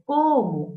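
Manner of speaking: teacher showing emphasis; emphatic cómo. Shown as a demonstration. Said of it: "Imagínate cómo habrá vivido".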